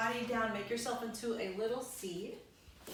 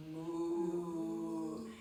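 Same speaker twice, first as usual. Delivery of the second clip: low playful moo; cow moo; 5 semitones lower, 3 dB quieter than usual. Pretend. Teacher mooing for the cow pose, low and playful.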